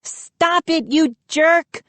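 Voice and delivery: high mock angry scream; Mindy yells at Moose on the table, mock scream. A narrator's reading. High angry yell. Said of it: "Mindy screamed".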